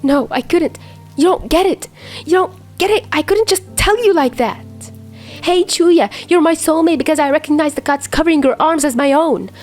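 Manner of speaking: tearful. A narrator's reading. Dazai answering Chuya through his own tears, desperate. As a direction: defend yourself through tears. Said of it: "his own tears falling".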